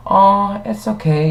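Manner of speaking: mock sympathy starting high; condescending pity. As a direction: imitate a pitying stranger, voice lifted then sinking. Imitating pitying park guests.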